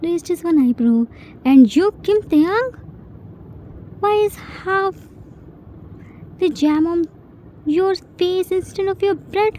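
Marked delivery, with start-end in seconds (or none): 1.5-9.5 mock-stern scolding. Jin scolding the child.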